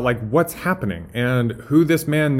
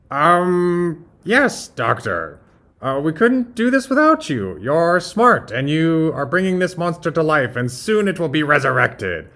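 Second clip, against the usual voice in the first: higher, placating henchman voice; Igor; 5 semitones higher, 4 dB louder than usual. Acted. Assistant humors the doctor, high and placating. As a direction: flatter the master after a wary start. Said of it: "imagine Doctor Frankenstein and Igor".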